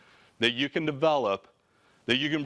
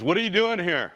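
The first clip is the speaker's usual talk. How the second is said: high, sharp retold confrontation; mock angry demand; 8 semitones higher, 3 dB louder than usual. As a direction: play the irritated owner, pitch up. Owner confronts him in office.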